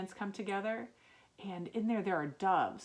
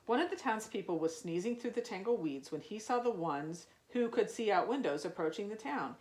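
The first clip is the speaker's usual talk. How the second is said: usual speaking voice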